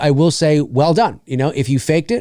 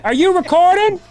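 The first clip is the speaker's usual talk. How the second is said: high, put-on prankster voice; high hoaxer voice; 13 semitones higher than usual. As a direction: voice the hoaxer rigging the chair, high. Mocking the video maker as a hoaxer.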